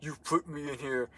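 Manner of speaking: slow, soft mock-whiny voice; mocking quote. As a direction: quietly mimic her accusation. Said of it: "is like".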